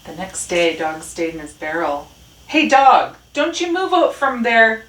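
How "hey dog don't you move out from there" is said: high, loud call. A narrator's reading. Rat calls to Dog in his barrel, loudly. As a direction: shout a warning across the yard. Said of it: "called Rat".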